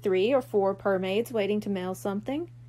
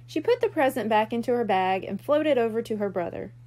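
usual speaking voice

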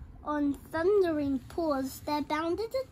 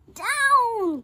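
shouted; 7 semitones higher, 10 dB louder than usual. Acted. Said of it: "cried the witch".

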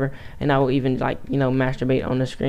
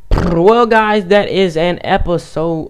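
slow, raised-voice episode wrap-up; loud sign-off; 4 semitones higher, 7 dB louder, much slower than usual. Real, not acted. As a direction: belt out the sign-off, drawn out. Big sign-off after losing, then redone.

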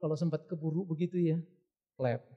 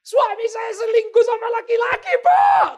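high, loud sobbing cry; crying wife; 13 dB louder than usual. Acted. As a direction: sob out the confession. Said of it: "Satu orang telepon saya". (istri menangis keras dan tinggi)

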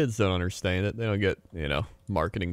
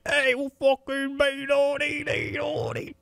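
very high, drawn-out English yelling; mock English accent; slower than usual. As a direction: squawk like a hammed-up English brawler. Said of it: "then in England's like".

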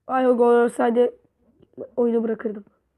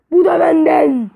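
higher, louder boast; taunting boast; 5 semitones higher, 7 dB louder than usual. Real, not acted. Gamer boasting after a goal, loud taunting. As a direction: slam the claim home.

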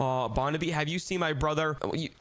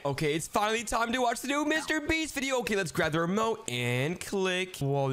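usual speaking voice

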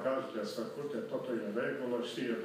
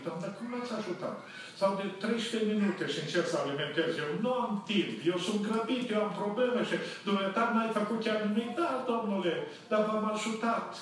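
raised, higher voice scolding the cashier; hammed-up indignant complaint, pitched up; retold angry complaint; 8 semitones higher than usual. Pastor scolding the cashier at the fuel station, indignant and raised.

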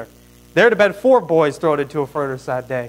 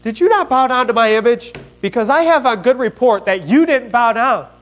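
higher-pitched, acted interrogation; angry king; 6 semitones higher, 4 dB louder than usual. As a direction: confront the captives as an angry king. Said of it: "in his fury and his anger".

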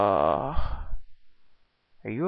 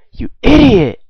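loud high shout; furious shout; 10 semitones higher, 17 dB louder than usual. Acted. Boss screaming at henchman who shot clerk, furious. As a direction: scream it at the fool.

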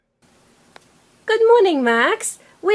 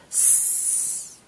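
whispered sound; 15 dB quieter than usual. Shown as a demonstration. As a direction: whisper just the S hiss. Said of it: "sound it out first".

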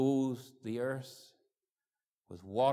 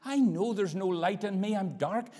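builds to a loud, high pleading prayer; preaching at full force; 13 semitones higher, 11 dB louder than usual. Retold or imitated. Teenager praying to God, rising to loud preaching plea. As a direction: cry out for mercy.

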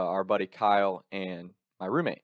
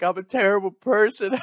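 mock crying; 11 semitones higher, 7 dB louder than usual. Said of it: "I'm crying to my parents".

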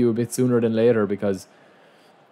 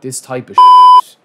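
drawn-out, high, much louder frustrated yell; slow exasperated scream at the game; 14 dB louder, slower than usual. Real, not acted. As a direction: let it rip at the screen in frustration. Raging at own gameplay.

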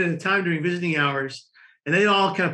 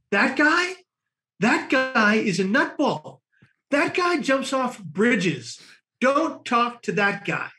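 lightly voiced kid, slow and higher; a hint of a disgusted teen; slow mock warning; 6 semitones higher, much slower than usual. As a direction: drag it out like a scandalized warning. Kid warns cousin about him.

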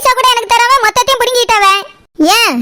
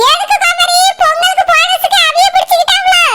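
higher, shouted taunt with a laugh; sarcastic mockery; 7 semitones higher than usual. Mother-in-law mocks the pay cut. (சிரிப்புடன் கிண்டலான உரத்த கேள்வி)

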